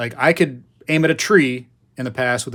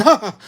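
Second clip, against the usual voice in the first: mock yell; 9 semitones higher, 7 dB louder than usual. Flinging the squirrel off.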